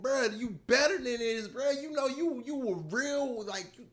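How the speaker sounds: high-pitched mock scolding of a friend; Tommy scolding. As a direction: imitate Tommy telling him to get it together. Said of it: "Tommy wasn't playing".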